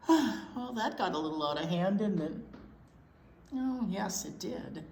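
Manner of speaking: ordinary delivery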